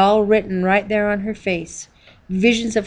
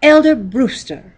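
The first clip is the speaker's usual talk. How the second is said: through clenched teeth; 6 semitones higher, 8 dB louder, slower than usual. A narrator's reading. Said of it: "she spoke through clenched teeth".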